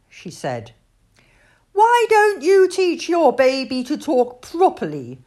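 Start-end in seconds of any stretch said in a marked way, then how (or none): 1.7-5.2 put-on high, loud child's question; complaining small boy. A narrator's reading. Bernard asking Alfie about his baby sister, high boyish complaint. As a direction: pipe up like an exasperated little boy. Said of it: "Bernard asked Alfie".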